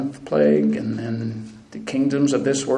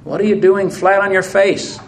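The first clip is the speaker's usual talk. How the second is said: God's command higher and louder, then the question; God sternly rebuking Joshua, raised voice; sharp correction; 3 semitones higher, 3 dB louder than usual. Acted. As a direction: jolt him off the ground with the first words. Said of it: "Joshua is corrected rather sharply".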